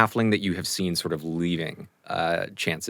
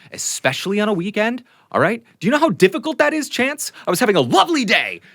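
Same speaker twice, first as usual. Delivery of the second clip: builds from mild to a much higher, louder rant; halfling tirade; 7 semitones higher, 4 dB louder than usual. Acted. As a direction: work yourself up into a furious rant. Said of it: "he just kind of launches into a tirade".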